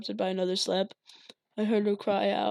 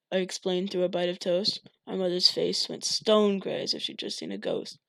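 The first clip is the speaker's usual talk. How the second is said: ordinary delivery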